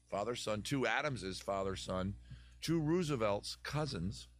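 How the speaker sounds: usual speaking voice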